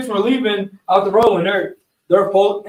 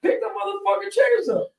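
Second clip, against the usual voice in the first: high mock command; 11 semitones higher, 5 dB quieter than usual. Retold yelling at the girls.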